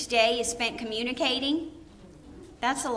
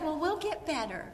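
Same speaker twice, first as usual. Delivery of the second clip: sheepish reply; 7 semitones higher, 6 dB quieter than usual. Retold or imitated. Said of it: "I said".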